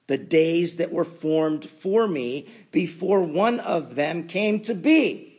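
loud, high scripture proclamation; preaching at full force. Acted. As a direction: proclaim it with fervor. Scripture read with rising force.

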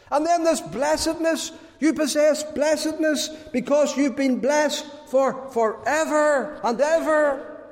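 high, ringing preacher's delivery; fervent preaching. Real, not acted. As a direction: preach it with mounting fervour, pitched high. Preaches with rising fervour.

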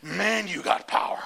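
slow, high awestruck voice; high, slow mock awe; awed friend. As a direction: marvel at the preacher's power. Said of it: "he said".

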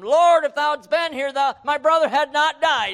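loud, high anguished plea to the Lord; anguished cry. Acted. Preacher voicing Mary crying out to Jesus, anguished and loud. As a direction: cry it out as the grieving sister.

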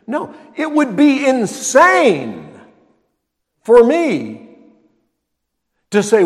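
preaching at full force. Real, not acted. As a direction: thunder it from the pulpit. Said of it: "preaching emphasis".

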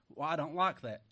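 ordinary talk

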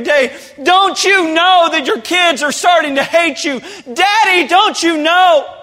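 high, loud, anguished pleading; pleading shout. Acted. Preacher pleading with the drinking father from the couch, loud. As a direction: beg the drunk father to see what he's doing. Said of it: "look at that man and say".

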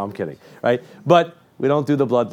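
ordinary talk

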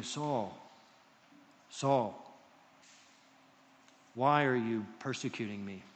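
lightly voiced divine call, spaced out; low, measured call with long pauses; voice from heaven. Heaven's voice questions Saul on the road, low and solemn. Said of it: "he hears a voice from heaven".

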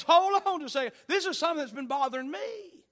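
acted-out agitated objection, pitched high; worried interjection. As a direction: butt in urgently with a nagging worry. Acted Philip interrupting with worry.

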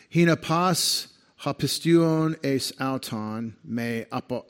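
slow, careful Koine Greek reading of "Houtos gar". Shown as a demonstration. Preacher reading John 3:16 to the congregation in Greek, slow and careful. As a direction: sound out each Greek word deliberately. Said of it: "I'll read it as I, best I can in Greek".

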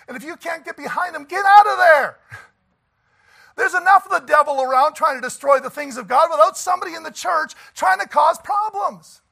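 thundering preacher, slowing on the last words; preaching shout. Real, not acted. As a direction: build it up until you are shouting at the church.

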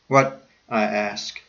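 usual speaking voice

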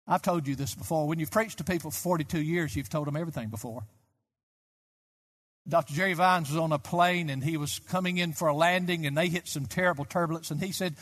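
ordinary talk, nothing marked

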